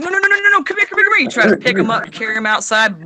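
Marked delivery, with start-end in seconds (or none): 0.0-1.2 high, rapid-fire acted panic; frantic mock pleading. Owner racing to grab the retching cat, hammed-up frantic pleading. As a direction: plead urgently, way up in pitch.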